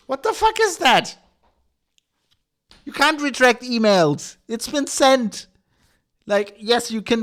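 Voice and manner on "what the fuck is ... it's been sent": loud, high-pitched indignant ranting; exasperated outburst. Real, not acted. Ranter objecting to retracting sent emails, exasperated outburst. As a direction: burst out high and loud, in disbelief.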